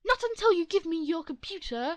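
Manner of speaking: loud high insistent demand; angry demand. Acted. The other mom refusing to leave, loud. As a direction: demand it fiercely. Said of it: "mother demands computer".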